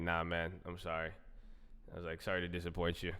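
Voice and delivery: low, soft deadpan sorry; retold apology. Answering the let-down pledges, deadpan apology. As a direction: drop down quietly to apologize.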